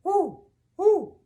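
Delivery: high, loud paired hoots; two loud owl calls. Acted. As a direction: hoot like an owl, high and round. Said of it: "And the owl said".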